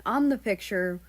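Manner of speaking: plain reading voice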